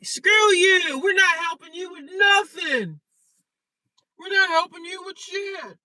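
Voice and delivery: high, loud mocking shout; family yelling. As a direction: yell it like the family slamming the door. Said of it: "And my family said".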